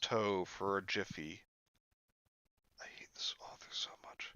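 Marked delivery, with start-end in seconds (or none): 2.8-4.3 very soft, whispered aside; exasperated whisper. Real, not acted. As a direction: whisper your exasperation to yourself. Exasperated aside under the breath.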